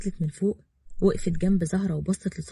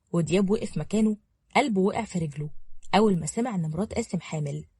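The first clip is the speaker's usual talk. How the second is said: usual speaking voice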